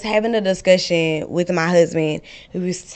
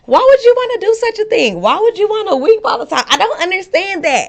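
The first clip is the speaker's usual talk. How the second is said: much higher, louder baffled husband voice; mock husband; 12 semitones higher, 6 dB louder than usual. Wife mimicking her husband's confused question, high and loud. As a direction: play the husband squeaky and bewildered. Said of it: "And he was like".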